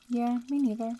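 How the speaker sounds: plain reading voice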